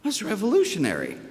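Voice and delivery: proud, excited exclamation; delighted exclamation. Real, not acted. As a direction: burst out with real delight at the idea. Praising the child's choice, delighted.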